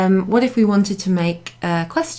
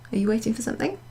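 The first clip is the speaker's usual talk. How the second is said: softer, higher question rising on "something"; questioning intonation; 4 semitones higher, 6 dB quieter, much faster than usual. Shown as a demonstration. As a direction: lift the end of "something" to ask it. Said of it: "we use our intonation to show that it's a question".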